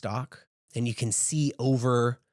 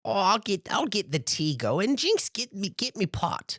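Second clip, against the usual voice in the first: put-on a much higher old granny, fondly scolding; 8 semitones higher than usual. Granny scolding her grandson Jinx, high and fond. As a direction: play the fussing giant grandma, pitched way up. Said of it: "she's like".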